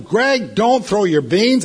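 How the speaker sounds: a fed-up dad's loud, slow yell. Retold or imitated. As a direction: bark the order at the toddler. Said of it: "I yelled at him".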